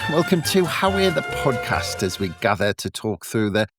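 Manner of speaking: ordinary talk, nothing marked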